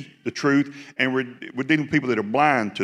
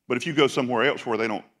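ordinary talk

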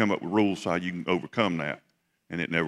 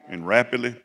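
ordinary talk

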